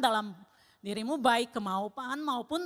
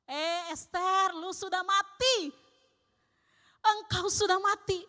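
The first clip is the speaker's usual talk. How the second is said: slow, higher, stern and solemn; voice of God; 7 semitones higher, 4 dB louder, slower than usual. Retold or imitated. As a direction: let God's rebuke land slowly. God's voice quoted to her. (suara Tuhan menegur dengan pelan dan tegas)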